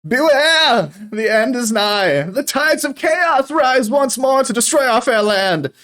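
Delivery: high, loud shrieking warning to the crowd; shrieking doom prophet. Acted. Old dwarf shrieking doom at the crowd, high and loud. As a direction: cry doom like a street prophet. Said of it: "he's shrieking into the crowd".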